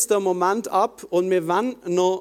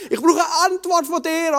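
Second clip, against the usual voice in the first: full-throttle plea; 11 semitones higher than usual. Retold or imitated. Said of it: "volle Pulle mitgemacht".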